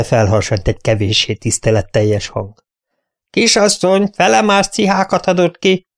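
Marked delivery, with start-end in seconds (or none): 3.3-5.9 high, a hint of a cheeky maid; lightly voiced impertinent servant. Maid pointing out a mistake to the companion, lightly cheeky.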